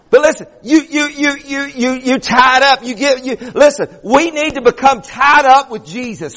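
high, driving sermon delivery; fervent preaching. Real, not acted. Preacher urging the congregation about Jacob wrestling God, fervent preaching. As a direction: preach it hard, stretching the key words.

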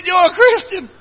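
very high mock-tearful question; imitated sobbing. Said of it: "this businessman's a sobbing".

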